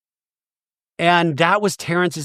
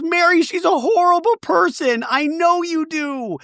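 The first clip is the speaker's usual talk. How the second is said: much higher, hammed-up shrill protest; mock outraged; 13 semitones higher than usual. Angie complaining to Mary, high mock outrage. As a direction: squeal like a wounded friend. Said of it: "Angie goes".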